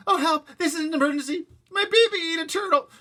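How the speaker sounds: high, loud frantic plea; panicked cry. A narrator's reading. Mother crying for help to the operator.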